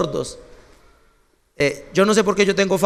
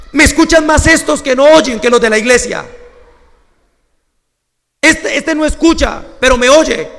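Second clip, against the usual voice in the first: loud, raised cry of wonder; emotional exclaiming; 5 semitones higher, 5 dB louder than usual. Retold or imitated. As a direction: burst out in moved amazement. Pastor's emotional outburst. (el pastor, conmovido, exclama fuerte)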